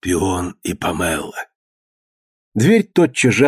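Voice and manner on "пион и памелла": slow, hushed password; whispering a secret password. A narrator's reading. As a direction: murmur the password low and slow. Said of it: "прошептал пароль". (шепчет пароль медленно и тихо)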